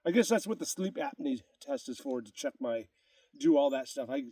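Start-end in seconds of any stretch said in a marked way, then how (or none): none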